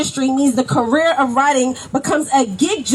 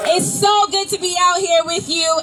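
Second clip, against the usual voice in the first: normal talking voice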